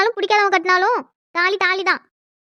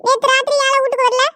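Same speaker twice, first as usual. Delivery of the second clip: put-on old village grandmother; 5 semitones higher than usual.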